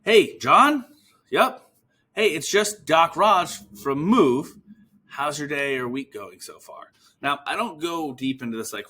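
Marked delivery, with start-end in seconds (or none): 0.0-6.9 higher and louder, slow upbeat greeting; friendly phone opener. Shown as a demonstration. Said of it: "and you'll be like".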